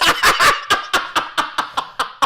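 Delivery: high, wheezing laugh fit; helpless laughter. Real, not acted. Storyteller cracking up at friend in tears, helpless high laughter. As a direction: crack up at your own story.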